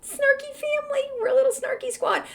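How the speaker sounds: a high, quick, giddy address to the fans; squealy high. Real, not acted. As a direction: squeal it out with delight. Affectionate address to listeners.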